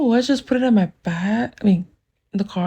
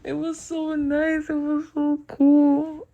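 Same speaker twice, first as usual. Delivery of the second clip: soft, slow, high swoon; drawn-out hushed delight; dreamy swooning; 4 semitones higher, 7 dB quieter, slower than usual. Real, not acted. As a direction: sigh it out, giddy and lovestruck. Swooning over the dream kiss.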